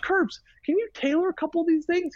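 high, pleading mock voice; a put-upon plea to a friend; pleading request. Teammate begging Curbs to tailor his sleeves, high mock plea. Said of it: "I said".

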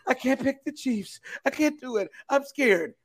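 high whimpering fake fear; mock scared voice. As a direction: whine like a scared fan. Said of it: "And here he comes".

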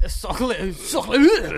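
loud, high hammy Sacre bleu; over-the-top French accent. Pretend. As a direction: ham up a French chef. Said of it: "mocking French".

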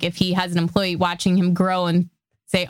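normal talking voice

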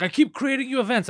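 high-pitched mock whine; imitated panic. Host mimicking his confused friend on the phone, high panicky whine. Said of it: "He starts panicking".

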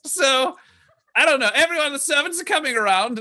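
high, gushing party-host voice; flamboyant aristocrat. Acted. Beloved heir welcomes guests to the alignment, high gushing voice. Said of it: "And he says".

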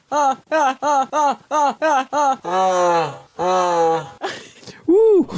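hot food panting; burnt mouth. Real, not acted. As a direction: gasp from a burning bite.

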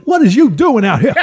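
high, exaggerated put-on voice; hammed-up celebrity impression; Samuel L. Jackson impression. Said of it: "Sam Jackson impression".

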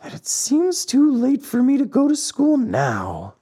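high, plaintive puppet whine; high-pitched childish complaint; whining. A narrator's reading. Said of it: "whined the marionette in a whisper".

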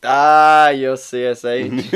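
long, loud "Ah" then drawn-out verdict; big, loud drawn-out reveal; mock exclamation. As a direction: burst out loud, then stretch the words. Mock verdict delivered to office guests.

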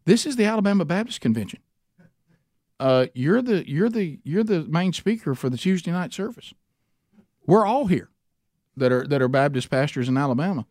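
high and quick, spelling out the big news; excited pastor. Retold or imitated. Pastor out front tells the speaker what the event is, excited and high. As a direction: tell him he's the main speaker, amazed.

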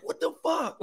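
high startled outburst; panicked. Retold or imitated. Son startled as monitor goes flat, high blurt. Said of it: "I'm like".

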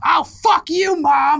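high, loud bratty yell; bratty shout. Retold or imitated. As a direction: scream it like a spoiled kid. Daughter yelling at mother.

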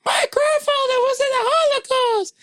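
very high put-on squeal; mock accuser. Someone bringing you up in conversation, high-pitched mock accusation. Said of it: "If they bring you up in conversation".

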